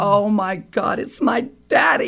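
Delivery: high cry of joyful shock; tearful, astonished exclaim; overcome with emotion. Retold or imitated. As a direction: gasp it out, almost in tears. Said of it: "And I go".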